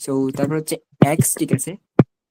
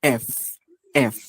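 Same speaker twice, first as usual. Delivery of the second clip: ordinary talk, nothing marked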